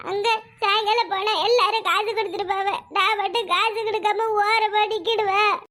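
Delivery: slow, tearful put-on whine; fake crying. Son afraid of being left out, fake tearful whine. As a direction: complain through pretend sobs. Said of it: "வாசல்ல உட்கார்ந்து அழுதுகிட்டு நிக்கிற".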